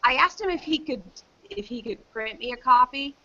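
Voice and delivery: normal talking voice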